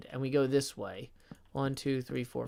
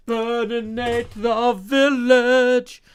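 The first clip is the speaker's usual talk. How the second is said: louder, very slow, dragged-out command; slow booming proclamation; 7 semitones higher, 9 dB louder, much slower than usual. Acted. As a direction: proclaim it like a dragon's decree. Reading tip as Trogdor quote.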